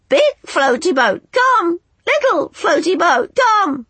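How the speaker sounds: very high, loud screech; screeching birds. A narrator's reading. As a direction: screech it like seabirds. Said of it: "screeched the angels".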